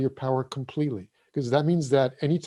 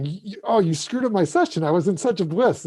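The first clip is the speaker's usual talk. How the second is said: higher, louder aggrieved protest; mock indignant complaint; 7 semitones higher, 5 dB louder than usual. Breathworker blaming whoever interrupted the session, in mock indignation. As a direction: act out blaming someone, pitched up and emphatic.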